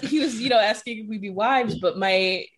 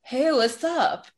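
put-on high, drawn-out drunken greeting; drunk lady voice; 7 semitones higher, much slower than usual. Mom answering her child's call while partying, drunk lady voice. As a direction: imitate a tipsy mom, stretching each word. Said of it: "drunk lady voice".

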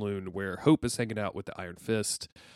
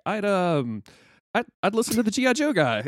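higher, louder, hesitant rambling; Da Vinci, nervous; 6 semitones higher, 7 dB louder than usual. Acted. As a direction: stammer out an unsure non-answer. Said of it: "And he's like".

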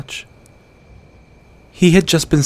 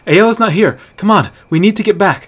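urgent; 9 semitones higher, 7 dB louder than usual. Acted. Hunter pleads urgently.

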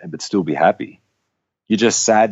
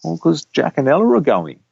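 higher-pitched imitation of a little boy; small child's voice, pitched up; Axel; 9 semitones higher than usual. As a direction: mimic a toddler explaining to mummy. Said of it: "Axel said to, to Michelle".

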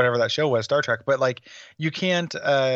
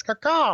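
high crow squawk; crow caw; 10 semitones higher than usual. Pretend. Jokes crow lives in chest.

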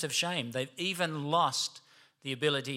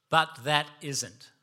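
slow, weighty "But that isn't"; emphatic BUT; slower than usual. Shown as a demonstration. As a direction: stress BUT and space out "that isn't". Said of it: "It's a big but".

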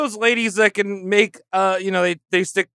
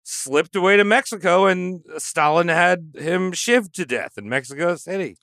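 ordinary talk

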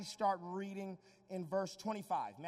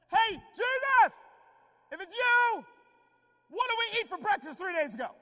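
mock shout; 13 semitones higher, 13 dB louder than usual. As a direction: yell a test question. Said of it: "Peter could have said".

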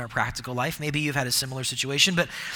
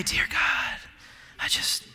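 breathy hushed prayer voice; mock solemn prayer opening, hushed; mock pious prayer; 4 dB quieter, slower than usual. Shown as a demonstration. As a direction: put on a reverent hush. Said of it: "inflect our voice to act passionate".

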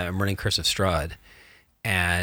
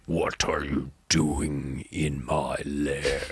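low, very slow booming dragon; 4 semitones lower, much slower than usual. Shown as a demonstration. DM demoing a dragon to the host, slow and low. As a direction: growl it out like a dragon guarding its lair. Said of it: "so your dragon voice".

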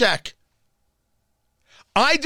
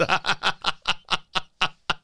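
a soft real laugh breaking through; 2 semitones lower, 6 dB quieter than usual. Real, not acted. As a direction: let the laugh out quietly, caught off guard. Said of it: "I'm not doing it right".

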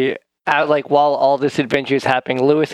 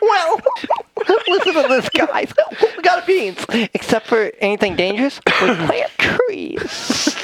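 high, laughing goofy voice; high goofy character voice; 9 semitones higher than usual. Acted. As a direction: play the excited bumbling character. Playing Lewis, high voice.

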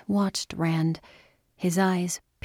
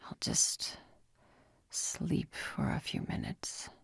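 drowsy whisper; 10 dB quieter than usual. A narrator's reading. Exhausted man dozing off.

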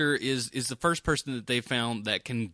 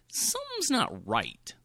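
uneasy viewer; 10 semitones higher, 3 dB quieter, slower than usual. Retold or imitated. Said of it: "you're watching and you're like".

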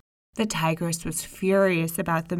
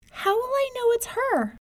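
put-on much higher small child voice; little girl voice. May May tells her mother, in a high child voice. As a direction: play the young girl, high and innocent.

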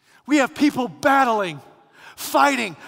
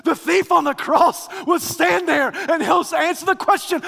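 usual speaking voice

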